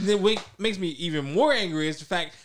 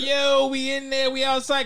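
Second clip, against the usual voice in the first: high, drawn-out hollered imitation; mock a friend calling out, stretched and high; Lugo; 8 semitones higher, 4 dB louder than usual. Friend mimicking a loud teammate on the game chat, high drawn-out mock holler.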